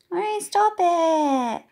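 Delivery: put-on high, loud, slow child cry; a kid telling another to stop; child in the playground, high and loud; child protesting. Children playing, one protests.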